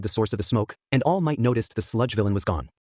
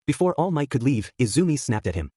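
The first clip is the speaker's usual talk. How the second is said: plain reading voice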